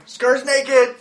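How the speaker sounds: high, loud mock announcement; joking shout. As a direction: call it out over the laughter. Shouted joke amid group laughter.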